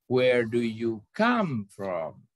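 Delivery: drawn-out question punching COME; stress on COME. Shown as a demonstration. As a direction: lean long on COME and let the end sink. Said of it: "Di mana tekanannya".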